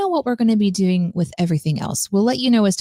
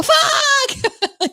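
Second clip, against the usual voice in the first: high yelled curse while falling; falling scream. Retold or imitated. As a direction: shriek it mid-tumble. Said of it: "on the way down, I go".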